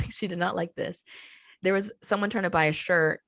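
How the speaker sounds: usual speaking voice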